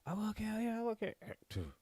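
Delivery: high, softened falsetto mock threat; raving madman. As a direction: rave weakly in a high voice. Plays raving McCoy worn out.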